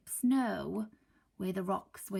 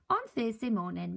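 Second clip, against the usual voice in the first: usual speaking voice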